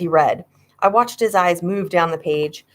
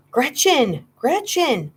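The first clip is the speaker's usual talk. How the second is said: frantic calling; 7 semitones higher, slower than usual. A narrator's reading. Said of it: "more frantic than ever".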